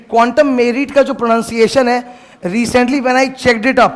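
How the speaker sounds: ordinary talk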